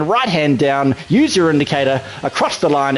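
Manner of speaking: ordinary talk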